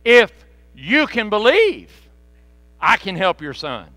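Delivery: loud emphatic Jesus, "If" hammered; Jesus turns it back on the father; firm Jesus. Retold or imitated. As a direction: challenge the father firmly. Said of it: "And he said".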